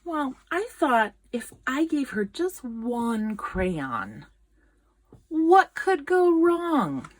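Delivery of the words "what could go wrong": slow, drawn-out and higher, full of irony; ironic foreboding. A narrator's reading. Narrator teasing listeners about the crayon, slow and ironic. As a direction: let the doom creep in, slowly.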